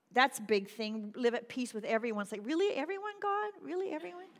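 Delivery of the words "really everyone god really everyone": high-pitched, disbelieving plea; incredulous questioning. Acted. Believer balking at God's command to love everyone, incredulous.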